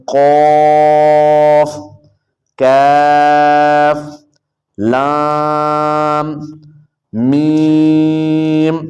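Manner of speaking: slow drawn-out chant of each letter name; letter recitation. Shown as a demonstration. (حروف تہجی آہستہ کھینچ کر پڑھنا)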